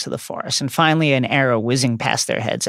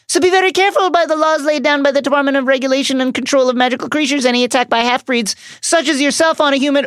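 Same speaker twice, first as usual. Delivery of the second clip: much higher, fast, prissy hammed-up official; panicky Umbridge; 11 semitones higher, faster than usual. Umbridge threatening the centaurs, hammed-up high and fast. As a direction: rattle off the regulations in a high panic.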